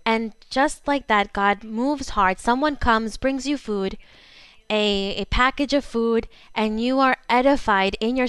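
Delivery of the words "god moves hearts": ordinary talk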